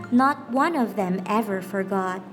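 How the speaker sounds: plain reading voice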